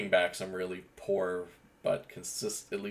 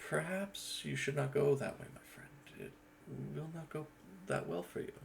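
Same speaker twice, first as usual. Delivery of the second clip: softer, hushed warning to a friend; a quieter take on the same warning; second character take; 7 semitones higher, 6 dB quieter than usual. Shown as a demonstration. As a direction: drop the voice and warn him gently. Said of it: "As opposed to".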